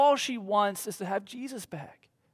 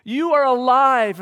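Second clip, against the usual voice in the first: high, louder and slowing, overwhelmed; joyful disbelief; 7 semitones higher, 7 dB louder, slower than usual. Retold or imitated. Mary realizing Jesus is alive, overjoyed and loud. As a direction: let the joy break through in disbelief. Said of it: "she can't believe it".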